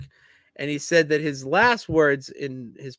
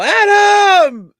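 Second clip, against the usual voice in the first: high, loud mock scream of "Adam!"; screamed name; 13 dB louder than usual. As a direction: yell the name at full blast. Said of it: "he just screams the person's name".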